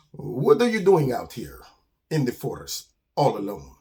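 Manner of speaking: put-on accented, measured skunk character; Armando the skunk. Skunk finds a lone bird in the forest, accented and curious. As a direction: question in deliberate accented phrases.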